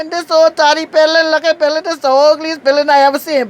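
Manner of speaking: hammed-up disgust; mock outrage. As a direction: tear into the ugly building. Insulting the building, retold.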